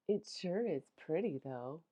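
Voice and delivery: ordinary delivery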